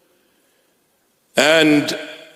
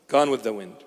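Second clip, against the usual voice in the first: low, much softer repeat; soft fading echo; 7 semitones lower, 10 dB quieter, faster than usual. Acted. Preacher echoing his own words, hushed and much softer. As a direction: let it fade like a sigh. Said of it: "echoed much softer".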